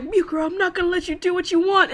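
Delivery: a lightly voiced resolute hero; heroic Tsuna. Tsuna to Mukuro, standing his ground, determined. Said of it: "He's all like".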